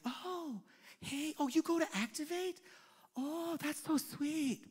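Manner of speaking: fake-sweet gossip. A gossip sweetly greeting a churchgoer to reel them in. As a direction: gush with fake warmth.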